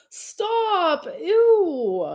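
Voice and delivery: high, drawn-out squeamish protest; grossed out. Real, not acted. Grossed out by a comment.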